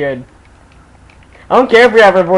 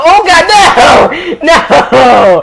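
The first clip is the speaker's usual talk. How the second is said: loud, much higher shocked cry; shocked yell; 10 semitones higher, 6 dB louder, much slower than usual. Real, not acted. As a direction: yell in sudden dismay. Falls off horse.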